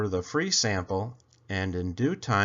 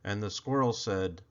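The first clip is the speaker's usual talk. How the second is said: usual speaking voice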